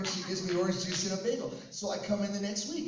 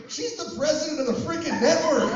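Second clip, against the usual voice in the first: loud, high mock astonishment; retold incredulous outburst; 8 semitones higher, 8 dB louder than usual. Coworkers revealing who Debbie really is, imitated loud disbelief. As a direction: blurt it out, imitating stunned coworkers. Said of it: "They go".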